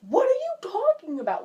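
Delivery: high, loud disbelief; incredulous. Retold or imitated. Answering a self-critical friend, high and incredulous. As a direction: pitch it way up in mock astonishment.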